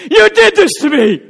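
high, loud yelling accusation; screaming at her husband in labor; mock labor scream. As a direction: shriek it like a woman mid-contraction. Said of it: "she looked at him in the labor pain and said".

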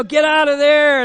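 dad yelling. Retold or imitated. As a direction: bark it like an angry father calling his son out of the swamp. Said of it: "he's just yelling at me".

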